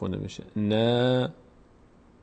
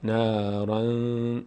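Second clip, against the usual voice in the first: slow drawn-out; much slower than usual. Shown as a demonstration.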